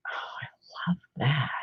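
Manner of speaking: slow, hushed whisper of delight; awed whisper. Real, not acted. Admiring the illustration for viewers, a delighted whisper. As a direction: breathe it out in wonder.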